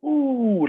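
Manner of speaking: high drawn-out "oooh" falling at the end; imitated crowd "ooh". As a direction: mimic a crowd reacting to a huge hit. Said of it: "hear the fucking crowd go".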